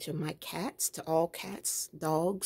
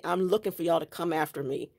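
ordinary talk

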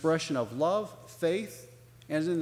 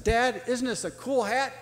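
put-on higher-pitched voice of an excited little girl; fascinated child; 8 semitones higher, 4 dB louder than usual. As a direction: show Dad your find, pitched up with wonder. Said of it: "was really fascinated by that hat".

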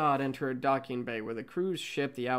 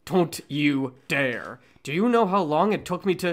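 heated argument; 4 semitones higher, 11 dB louder than usual. Acted. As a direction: drag out Don't you dare in outrage. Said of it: "The two constantly argue".

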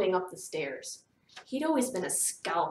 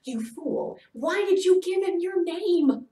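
loud, high-pitched exasperation; alarmed self-reproach; self-scolding; 10 semitones higher, 7 dB louder than usual. A narrator's reading. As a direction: berate yourself for a stupid slip. Girl scolds herself in her thoughts.

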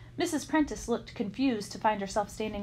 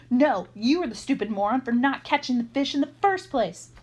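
louder, higher indignant retort; shouting back; 4 semitones higher, 6 dB louder than usual. A narrator's reading. Sanj blaming his accomplice right back, shouting indignantly. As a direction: throw the blame back, hard on the key words. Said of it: "Sanj shouted back".

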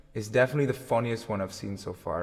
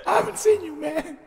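high hammed-up sobbing wail; mock sobbing. Streamer pretends to weep at his old friend, high mock sob. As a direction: fake a weepy reunion sob.

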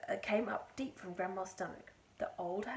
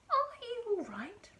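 high, louder polite question; polite concern; 12 semitones higher, 7 dB louder than usual. A narrator's reading. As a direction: check on her politely in a raised pitch. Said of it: "George asked her politely".

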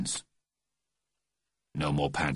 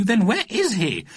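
higher worried lady voice; 11 semitones higher than usual. A narrator's reading. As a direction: ask like a puzzled mother. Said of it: "Missus Smythe Fortescue".

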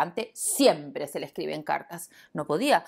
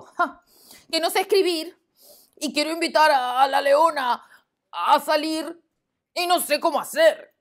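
loud, high complaining; exasperated lion; 11 semitones higher, 8 dB louder than usual. Acted. Lion to the hippo, loud high exasperation. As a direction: blurt out the problem.

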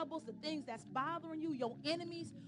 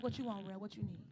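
low, casual answering voice, lightly acted; God showing up; 6 semitones lower than usual. God immediately showing up to answer a caller, lightly voiced. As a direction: answer the caller in a dropped pitch. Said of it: "immediately show up like".

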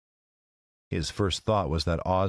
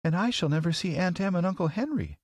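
put-on very high, tearful girl's voice; starting to cry; 12 semitones higher than usual. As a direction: play a little girl on the verge of tears. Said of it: "beginning to cry".

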